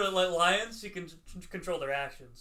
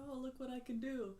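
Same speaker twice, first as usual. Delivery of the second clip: much higher, soft and delighted; 10 semitones higher, 7 dB quieter than usual. Retold or imitated. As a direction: marvel at a new trick. Villain discovering levitating cat.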